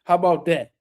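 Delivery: usual speaking voice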